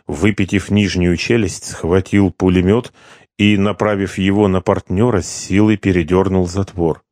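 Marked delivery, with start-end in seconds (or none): none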